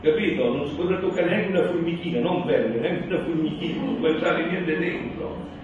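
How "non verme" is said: ordinary talk, nothing marked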